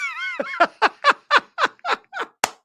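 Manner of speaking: high burst of real laughter; laughing. Real, not acted. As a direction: crack up at the joke. Reacts to comment.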